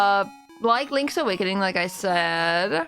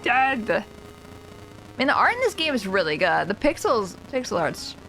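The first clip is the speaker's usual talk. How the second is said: usual speaking voice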